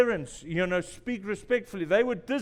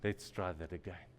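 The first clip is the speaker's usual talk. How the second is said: soft and much lower, soothing; calm coaching; 11 semitones lower, 8 dB quieter than usual. Retold or imitated. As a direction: talk them down gently.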